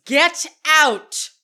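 high, loud dramatic outburst; acted angry shout. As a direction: bellow it like a diva throwing someone out. Acted row, Meredith ejects guest.

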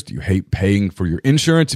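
ordinary talk, nothing marked

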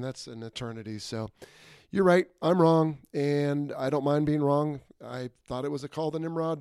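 usual speaking voice

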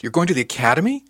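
shocked question. A narrator's reading. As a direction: voice leaps up in disbelief. Shocked at the academy news.

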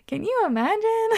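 high squeal of disbelief; incredulous. Real, not acted. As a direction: shriek it in disbelief. Incredulous repeat, much higher.

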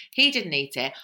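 ordinary talk

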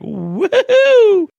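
high, loud whoop of joy; a giddy, celebratory woohoo; gleeful whoop. Retold or imitated. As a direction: cheer like you just won money. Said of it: "I'm like".

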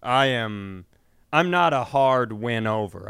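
ordinary talk, nothing marked

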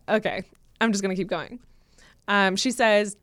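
normal talking voice

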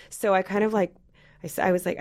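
usual speaking voice

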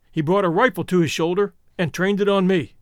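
plain reading voice

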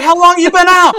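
high and loud across the water; ranger yelling. Retold or imitated. Ranger shouts the question across the raging river. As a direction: bellow the question over the river.

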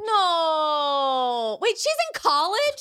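long wailed no, then high-pitched disbelief; real astonishment. Real, not acted. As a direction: squeal it, floored by the news. Astonished at news of daughter.

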